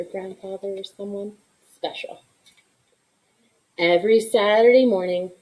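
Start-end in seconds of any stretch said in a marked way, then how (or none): 0.0-2.2 whispering. Real, not acted. Aside to young listeners.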